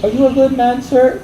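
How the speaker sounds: high-pitched put-on plea; imitating begging scammer. Mimics the begging scammer's question.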